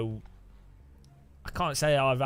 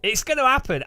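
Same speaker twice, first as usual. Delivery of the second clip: excited; 10 semitones higher, 6 dB louder than usual. Real, not acted. Excited prediction after laughing.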